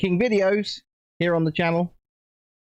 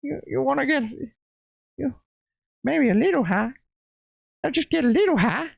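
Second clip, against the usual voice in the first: much higher, dopey wheedling cartoon voice; Towelie; 9 semitones higher than usual. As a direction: coax like a stoned cartoon towel. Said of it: "Towley just".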